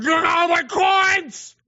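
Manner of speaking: high, slow gloating shout; gloating hoarder. Retold or imitated. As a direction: crow over your pile of coins like a miser. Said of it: "they're hoarding and going".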